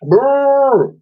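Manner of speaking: loud, high blown brrr; mammoth raspberry. Acted. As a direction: blow a cheeky raspberry. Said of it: "called out Wooly".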